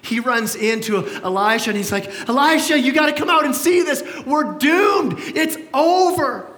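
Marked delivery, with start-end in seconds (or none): 2.3-6.5 high, loud panicked cry; mock-panicked servant, louder and higher. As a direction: blurt it out like a terrified servant who sees the army. Servant freaking out, retold.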